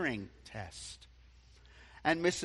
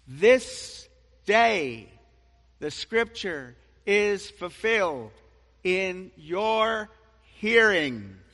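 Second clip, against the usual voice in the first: proclaiming; 4 semitones higher, 9 dB louder, slower than usual. Acted. Jesus proclaims in the synagogue.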